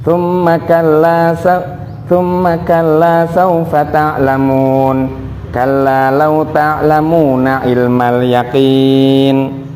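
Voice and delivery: slow chanted tartil recitation; drawn-out Quranic chant. Shown as a demonstration. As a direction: recite slowly and melodically with tajwid. Teacher recites Quran verses.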